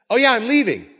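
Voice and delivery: high, loud sing-song afterthought; comically breezy announcement; mock casual reminder. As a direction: toss it out high, as if just remembered. Voices Jesus casually dropping a reminder.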